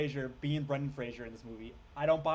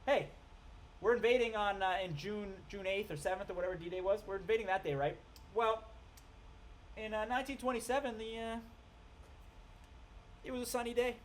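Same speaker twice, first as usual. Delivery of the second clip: higher, airy mocking of a clueless guy; dopey mock voice; 6 semitones higher than usual. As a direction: play him as a dim know-it-all. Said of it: "his logic is like".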